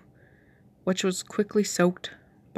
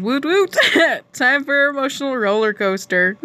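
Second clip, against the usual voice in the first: higher and louder, laughing it out; hyping up the listeners; excited outro; 7 semitones higher, 5 dB louder than usual. Real, not acted. Reader to viewers, laughing and excited after the chapter. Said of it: "reader's excited outro".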